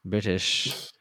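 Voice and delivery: lightly put-on British accent, detective voice; hint of a British detective introducing himself. Introducing a silly British detective character, light British accent. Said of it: "British detective".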